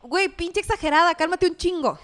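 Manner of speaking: mock insult. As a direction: sneer it at her like a dismissive jab. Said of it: "si quieres ofenderla".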